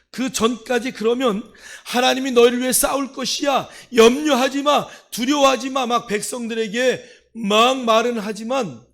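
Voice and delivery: very loud, high-pitched exhortation; full-force preaching. Real, not acted. Preacher voicing Moses urging the people not to worry, shouted preaching. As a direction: shout it out to the whole congregation, easing at the very end.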